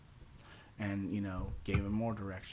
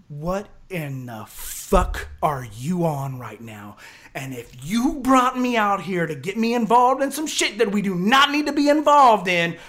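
slow furious start, then high shouted rant; angry retelling; 8 semitones higher, 14 dB louder than usual. Retold or imitated. Buddy chewing him out on pulling up, angry. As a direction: act out a livid buddy, building to a yell. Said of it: "he's looking at me all wide-eyed and kinda angry looking".

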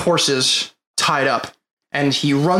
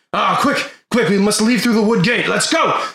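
urgent NPC voice; 5 semitones higher than usual. Acted. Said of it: "so Galandan says".

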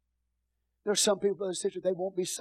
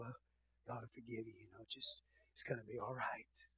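tender reassurance; 4 semitones lower, 5 dB quieter than usual. Retold or imitated. Pastor comforting a sinner who messed up, soft and tender. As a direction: ease them gently toward forgiveness. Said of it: "and say".

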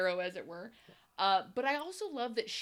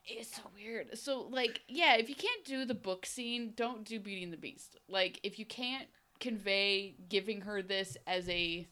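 ordinary talk

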